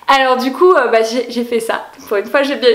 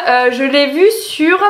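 ordinary talk

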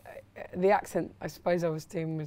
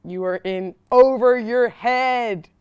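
put-on Philadelphia accent; 2 semitones higher, 12 dB louder, slower than usual. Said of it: "It's really good".

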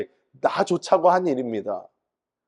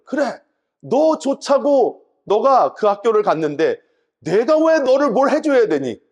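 mock scolding; 8 semitones higher, 4 dB louder, slower than usual. Father rebukes son's demand.

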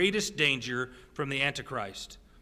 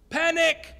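a sudden high yelp of fake panic, much higher and louder; mock panic; 12 semitones higher, 6 dB louder than usual. Preacher to his class, a hammed-up shout of panic. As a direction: blurt it like a joke alarm.

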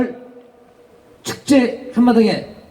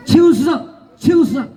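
calling out; 7 semitones higher than usual. Real, not acted. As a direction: call it out to the crowd. Calling out award name.

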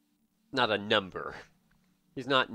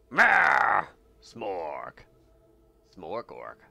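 mock orc grunt; 4 semitones lower, 6 dB louder, much slower than usual. Voicing the orc character.

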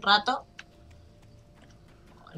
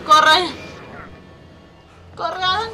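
higher, louder cries to run; urgent shouting; 9 semitones higher, 6 dB louder, slower than usual. Real, not acted. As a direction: yell him onward, frantic. Urging character to run. (gritando con urgencia)

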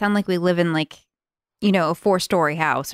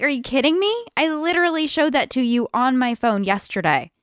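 higher-pitched exasperated protest; indignant, raised-pitch retelling; retold indignation; 6 semitones higher than usual. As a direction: replay your disbelief at being ignored. Wife re-enacts her indignant reaction.

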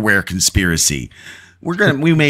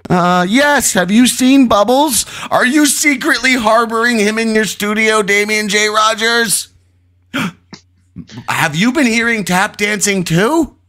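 much higher, frantic caller; panicked voice; 10 semitones higher than usual. Acted. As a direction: sound like you're falling apart. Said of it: "How was my panicked voice?".

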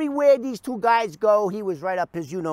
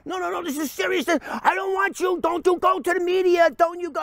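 high, pleading warning; panicked plea; 11 semitones higher than usual. Retold or imitated. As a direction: beg him not to tell.

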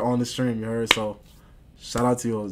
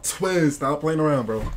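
upset; 3 semitones higher, slower than usual. Real, not acted. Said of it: "You're getting me upset".

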